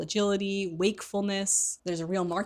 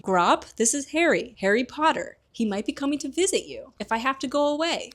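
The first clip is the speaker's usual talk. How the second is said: raised, hearty half-giant coaxing; Hagrid voice; 7 semitones higher, 3 dB louder than usual. Retold or imitated. Hagrid introducing Harry to his giant brother, hearty coaxing. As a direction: lift it up and coax gently. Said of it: "he goes up to Grawp".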